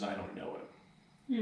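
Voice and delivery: quiet, heavy echo of the denial; hushed, grave recall. Retold or imitated. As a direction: let the shameful words fall quietly. Peter's last words about Jesus, recalled.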